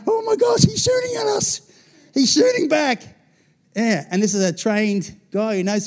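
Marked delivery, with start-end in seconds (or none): 0.1-3.0 high shrieking mock panic, loud; panicked soldiers. Preacher imitating soldiers shrieking in panic under fire.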